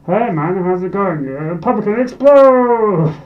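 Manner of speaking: casual greeting, then a loud, slow, high "pumpkin explode"; lightly voiced mock goblin party stunt; goofy party bit. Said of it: "I do it at parties".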